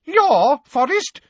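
very high, loud, outraged; indignant fright. Acted. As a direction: shriek in shocked indignation. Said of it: "shaking now not only with fright".

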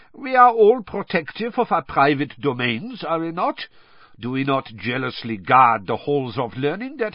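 smooth, guarded pride; oily defensive headmaster. Acted. Karkaroff voiced in audiobook.

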